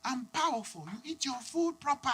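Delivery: high, soft, slow motherly coaxing; high-pitched, measured mum voice; African mom. Acted. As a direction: imitate an accented mother instructing her child. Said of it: "And the mom's like".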